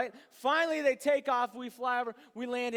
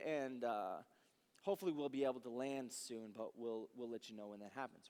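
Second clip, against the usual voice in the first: lightly voiced airline captain easing the cabin; pilot announcement; 9 semitones lower, 6 dB quieter than usual. Captain telling passengers about the storm, calm low announcement.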